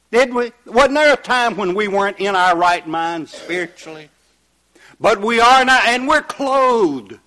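loud, high pulpit cry; impassioned preaching. Real, not acted. Preacher rejoicing with people in their right minds, impassioned preaching. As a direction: ring it out to the congregation.

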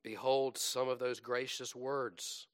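ordinary delivery

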